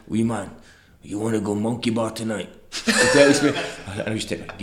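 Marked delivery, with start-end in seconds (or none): 0.1-2.5 lightly imitated Trinidadian accent; a hint of Trinidadian accent, relaxed and casual; Russell Latipi. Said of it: "obviously, the voice".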